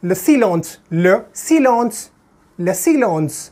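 le silence as French luh see-LAHNSS, nasal end; le silence repeated in French, slowly; French pronunciation. Shown as a demonstration. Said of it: "I would pronounce like this".

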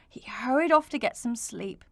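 ordinary delivery

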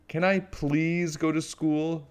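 high, drawn-out plea; begging. A narrator's reading. Little Witch asks her mother to let her go to school, begging. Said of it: "she begged".

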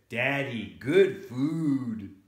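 loud, proud little announcement; mildly excited kid; child's excitement. Acted. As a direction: show it off to Daddy. Child proudly shows father food.

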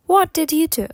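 quick connected question. Shown as a demonstration. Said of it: "потім швидше".

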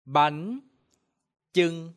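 "Bánh chưng" spaced, rising bánh, level chưng; Vietnamese pronunciation. Shown as a demonstration. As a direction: model "Bánh chưng" slowly. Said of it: "now repeat after me".

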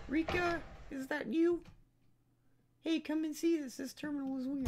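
put-on squeaky high girl calling out. Gamer voicing a girl calling for Rika, high pitched. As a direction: play a young girl calling a friend, very high. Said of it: "I gave her the same voice as Rika".

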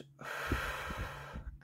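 long, audible exhale; demonstrated breath out. Shown as a demonstration. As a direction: blow the breath out slowly. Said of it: "Blow it out".

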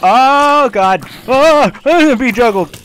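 very high terrified yelling; shrieking in panic; panicked shriek. Real, not acted. About to be juggled.

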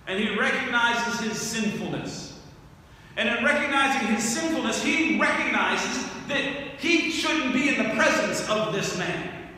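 ordinary talk, nothing marked

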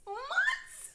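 high, loud disbelieving squeal; incredulous squeal. Real, not acted. Telling viewers the wait lasts months, squealing in disbelief. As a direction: shriek the word "Months?" in disbelief.

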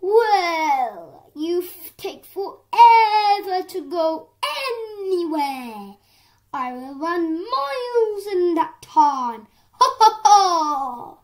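loud, high taunting laugh through the words; hammed-up mocking horse. A narrator's reading. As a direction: jeer at the snail, cackling. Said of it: "continued to mock".

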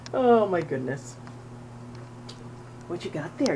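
ordinary talk

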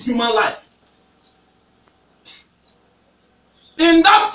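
fiery sermon with sudden shouts; preaching. Acted. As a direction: preach hard and build to shouts. Preacher exhorting congregation.

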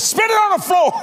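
high, hollered mock command; urgent acted shout. Voice ordering Kathy to spit, urgent yell. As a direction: cry it out high and forceful.

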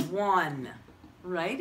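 ordinary talk, nothing marked

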